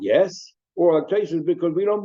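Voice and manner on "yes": YES with a rising question tone. Shown as a demonstration. Teacher shows Rashi's reading, questioning YES. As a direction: lift YES sharply into a doubting question. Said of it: "like, in a question mark".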